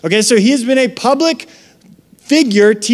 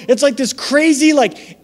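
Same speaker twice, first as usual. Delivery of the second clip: ordinary talk, nothing marked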